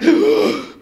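high, loud gasping breath; acted gasp. She recognises the man in uniform, acted gasp. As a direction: suck in air in mock shock.

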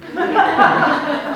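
laughing out high; big laugh after the quote; high burst of laughter. Real, not acted.

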